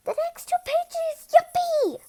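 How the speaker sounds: high squealing delight; excited cheer. Acted. A character cheering at the next pages, high and thrilled.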